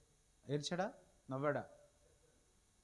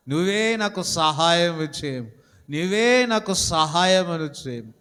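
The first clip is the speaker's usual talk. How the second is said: a pleading prayer that slowly gathers force; fervent prayer; 8 dB louder than usual. Acted. Preacher leading the church in a loud, slow prayer. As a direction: pray it out, swelling toward the end. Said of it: "చేయండి ఒకసారి".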